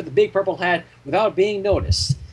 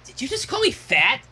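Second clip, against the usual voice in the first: raised, tomboyish pony voice; Rainbow Dash voice; 7 semitones higher than usual. Acted. Rainbow Dash affronted at being called fat. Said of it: "asked Rainbow Dash".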